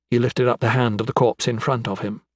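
plain reading voice

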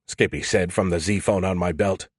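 usual speaking voice

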